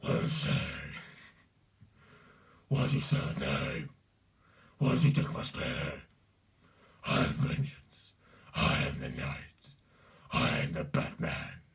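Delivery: very low, guttural rasp; dark gravel-throated avenger; Batman. Shown as a demonstration. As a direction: break your throat into a harsh vigilante growl. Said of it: "Batman's quite easy to do".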